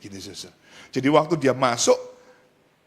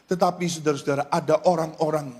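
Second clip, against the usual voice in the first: ordinary talk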